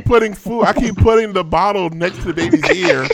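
high, loud and panicked; frantic parent voice. Acted. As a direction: blurt it out like a desperate new parent.

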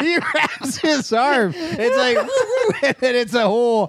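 slow, high, words squeezed out between laughs; can barely get it out for laughing; giggling through the story. Real, not acted. Telling joke while cracking up.

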